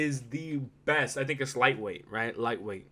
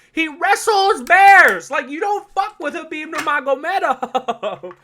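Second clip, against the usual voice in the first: loud, much higher and slow on "bears"; hyped exaggeration; 13 semitones higher, 10 dB louder, slower than usual. Real, not acted. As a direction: stretch it out in awe. Hyped, stretched repetition.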